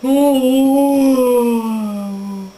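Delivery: long, loud whale moan; whale call. Pretend. Playing animal noises as a whale, moaning long. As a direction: hold a swelling whale-song note. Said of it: "I'm a whale".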